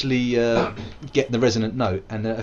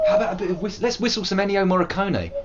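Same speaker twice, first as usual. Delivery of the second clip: usual speaking voice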